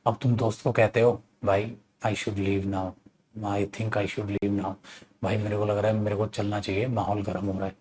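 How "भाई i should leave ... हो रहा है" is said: quietly edge out of a tense room; hushed aside. Retold or imitated. Whispering to friend amid fight.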